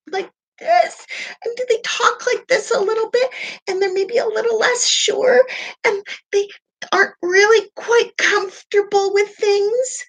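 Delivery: high, whiny, unsure voice; small insecure voice. Shown as a demonstration. Coach mimics someone who feels small and insecure, whiny high voice. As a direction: shrink the voice, play small and insecure. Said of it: "I'm using funny voices".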